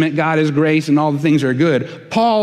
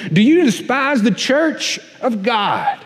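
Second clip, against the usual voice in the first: laying it on thick; 7 semitones higher than usual. Acted. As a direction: preach it like a furious apostle scolding his church. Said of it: "he lays it on thick".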